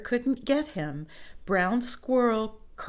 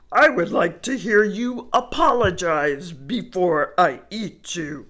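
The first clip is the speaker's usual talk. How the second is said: bear voice; 7 dB louder than usual. Acted. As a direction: answer like a gullible big bear. Bear agrees to listen.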